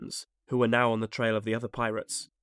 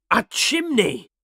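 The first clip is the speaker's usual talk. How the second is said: loud, high, drawn-out cry of discovery; astonished exclamation; 9 semitones higher, 7 dB louder, slower than usual. A narrator's reading. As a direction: exclaim it slowly in amazement. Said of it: "they both exclaimed".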